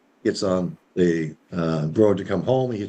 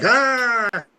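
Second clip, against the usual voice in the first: high-pitched wordless yelp; hammed-up cry of a stumped husband; exasperated "ah", pitched way up; frustrated cry; 3 dB louder than usual. Husband's exasperated reaction to wife.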